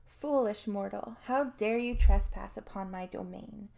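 big booming villain voice; loud theatrical demon threat; booming demon lord. Acted. Demon mock intro to human.

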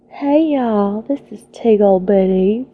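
put-on Southern US accent; sexy Southern belle. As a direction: greet them as a Southern belle. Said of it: "do a sexy Southern belle".

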